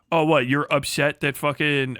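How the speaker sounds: ordinary delivery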